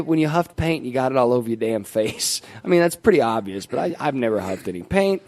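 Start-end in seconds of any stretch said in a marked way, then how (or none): none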